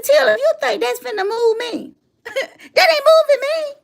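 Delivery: laughing defiance. Retold or imitated. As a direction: brush it off laughing. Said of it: "I said".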